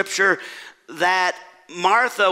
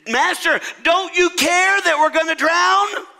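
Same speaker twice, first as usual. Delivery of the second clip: high, urgent cry for help; alarmed plea; 11 semitones higher, 4 dB louder than usual. Acted. Disciples in a storm at sea cry to Jesus, alarmed. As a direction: plead at a high pitch, rising in desperation.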